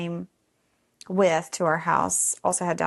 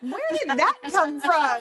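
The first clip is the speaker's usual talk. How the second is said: much higher and louder, amused disbelief; incredulous; 9 semitones higher, 3 dB louder than usual. Retold or imitated. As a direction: caught off guard by a silly idea. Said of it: "I was like".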